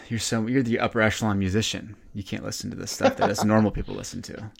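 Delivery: ordinary delivery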